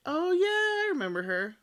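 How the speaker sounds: recognition. Retold or imitated. Looking up an old schoolmate online and remembering her, surprised. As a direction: act out a sudden memory coming back.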